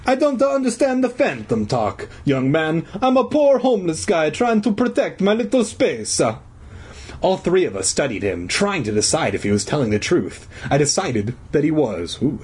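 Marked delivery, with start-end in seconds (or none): 0.0-6.2 put-on high, pleading Italian accent; aggrieved old Italian janitor. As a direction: protest in a hammed-up Italian accent. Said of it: "in his hoarse Italian voice".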